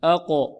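"أقو" with the q released in a short echo; bouncing qalqala. Shown as a demonstration. Teacher starts the drill with students, bouncing qalqala. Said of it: "نطبق الآن".

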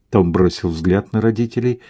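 ordinary delivery, nothing marked

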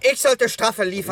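heated shouted protest. Acted. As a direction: insist loudly over the others. Heated argument, being cut off.

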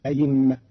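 "أئمة" said ayimma; hamza turned to ya. Shown as a demonstration. Teacher shows the other option, "أئمة" as ayimma. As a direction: put a clear y inside "أئمة". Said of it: "وإبدالها ياء".

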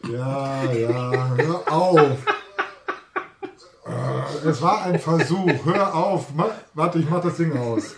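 loud, high laughter through the words; can't stop laughing, begging them to stop. Real, not acted. Laughing after failed sketch.

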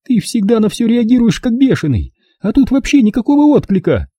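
very high, agitated mother voice; berating mother. Acted. Mother scolding unresponsive son, berating. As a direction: scold him at a high pitch.

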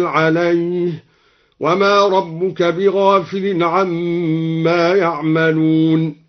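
slow chant, يرجع said "yarji'u", يعملون starting with ya; active-voice reading. Shown as a demonstration. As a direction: recite slowly with a on the yaa and i on the jeem of يرجع. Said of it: "بفتح الياء وكسر الجيم". (تلاوة مرتلة بفتح ياء يرجع وكسر الجيم)

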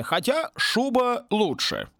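slowed, drawn-out joke; slow dry quip. Acted. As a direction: lazily toss off the pun. (медленно, с ленцой)

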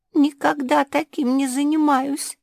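high-pitched, mildly weepy protest; tearful. A narrator's reading. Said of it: "заплакала Шпиц".

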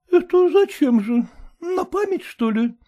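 put-on high, cheeky girl's voice; high mocking daughter; pert young daughter. A narrator's reading. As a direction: tease the mother lightly.

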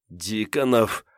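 strained appeal to a captor; speaking through pain. A narrator's reading. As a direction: push the words out through pain. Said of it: "сквозь боль проговорила Ольга".

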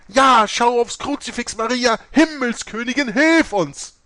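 high, loud frantic calling; nuns crying out. Acted. Nuns behind her crying out loud for Mary's help. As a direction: cry out to the Virgin in panic. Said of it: "Die Nonnen hinter ihr riefen".

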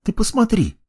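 high, urgent call; excited. Acted. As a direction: point it out eagerly. Masha points out mages.

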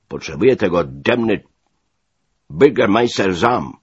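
loud, commanding officer; bossy American major. A narrator's reading. Major orders his new man about, loud and bossy.

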